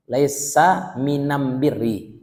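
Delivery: minam birri very slowly, am instead of al; slow dialect demo. Shown as a demonstration. Teacher slowly demos the dialect am for al. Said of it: "Kalau logat orang ini".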